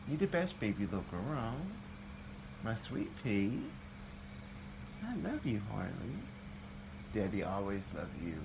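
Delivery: soft, tender sweet talk to a pet; sweet nothings. Real, not acted. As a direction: murmur it lovingly, softly. Sweet talk to his cat.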